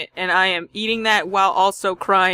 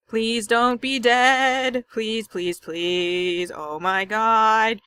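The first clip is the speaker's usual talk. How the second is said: slow, drawn-out desperate begging; desperate pleading; 2 semitones higher, much slower than usual. Real, not acted. Player racing to Sayori's house, slowly pleading she isn't dead. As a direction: beg slowly that she's alive.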